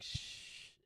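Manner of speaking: soft unvoiced "shh"; imitated shush. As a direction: hush them so nobody gives you away. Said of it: "He was like".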